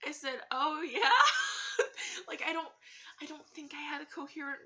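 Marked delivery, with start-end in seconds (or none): 0.5-2.3 high, loud laughing oh yeah; laughing reply. Real, not acted. Laughing oh yeah at a customer claiming kinship with a doll. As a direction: blurt it out through nervous laughter.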